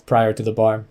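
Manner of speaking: usual speaking voice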